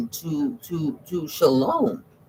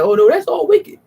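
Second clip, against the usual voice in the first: mock church voice; 7 semitones higher, 5 dB louder than usual. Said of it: "they be like".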